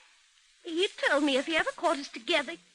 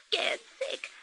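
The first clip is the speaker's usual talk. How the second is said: breathy frightened whisper; 6 semitones higher, 6 dB quieter than usual. Acted. Frightened woman awaiting violent lover.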